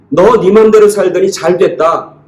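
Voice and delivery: high, drawn-out mocking taunt; sarcastic jeering. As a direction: jeer at their downfall, stretching the last words. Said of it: "그러면서 비아냥거리고 손가락질 할 만한데". (높은 어조로 비아냥거리듯)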